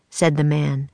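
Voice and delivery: usual speaking voice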